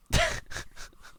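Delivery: airy silent laugh; stifled wheeze of laughter; breathy laugh. Real, not acted. Laughing after the quote.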